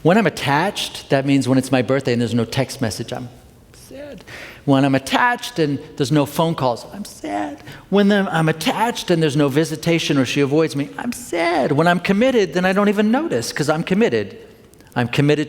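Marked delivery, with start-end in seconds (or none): 3.1-4.2 tiny high, soft whimper; mock sad. Attached brother with no birthday text, mock sad. Acts out being hurt when attached. 6.9-7.6 high, soft little whimper; hammed-up pitiful squeak; mock sad. Attached brother who got no phone call, mock sad. Acts out being hurt when attached. 11.0-11.7 very high pitiful whine; mock sad. Attached brother avoided by sister, mock sad. Acts out being hurt when attached.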